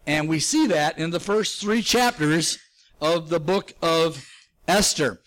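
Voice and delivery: ordinary talk, nothing marked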